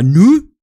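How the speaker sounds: Nu said short and loud; clipped Saxon Nu for yes. Shown as a demonstration. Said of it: "zwei verschiedene Arten, Ja zu sagen".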